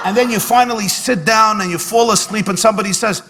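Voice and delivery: ordinary delivery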